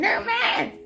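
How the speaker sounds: high laughing singsong taunt; real delighted laughter at the spirits; gleeful laughing. Real, not acted. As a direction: cackle through it in glee.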